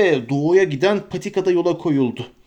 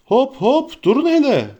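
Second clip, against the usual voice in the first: high, loud jolly halt; old man shouting stop; 9 semitones higher, 4 dB louder than usual. Acted. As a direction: stop them cheerfully, pitched high. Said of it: "diye bağırdı yaşlı adam".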